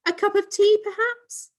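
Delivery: very high, affected posh lilt; put-on posh voice. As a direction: put on airs for the guest. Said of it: "she was putting it on to impress".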